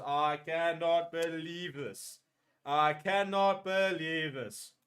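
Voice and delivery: slow, drawn-out amazement; slow disbelief. Real, not acted. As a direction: let each word sink in, stunned. Stunned after kick.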